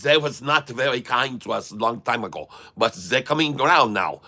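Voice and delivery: hammed-up German accent for stock chat. Streamer ranting about SoFi buyers, in mock German accent. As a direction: keep a thick comic German accent throughout. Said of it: "No, this is my German accent".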